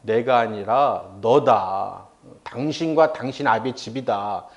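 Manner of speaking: low, very slow, weighty retort; bold rebuttal. Retold or imitated. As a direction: stand firm and lay each word down slowly. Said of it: "담대하게 말합니다".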